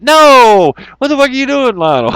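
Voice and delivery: mock furious scream at Lionel; outraged shout. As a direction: yell at the screen in outrage. Reacting to Lionel's pitch.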